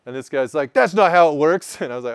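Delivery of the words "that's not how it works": louder, higher insistent correction; retold retort. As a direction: snap back that he's wrong. Buddy retorts in retold argument.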